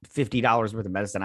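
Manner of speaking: usual speaking voice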